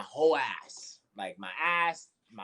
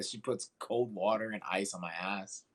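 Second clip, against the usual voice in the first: ordinary delivery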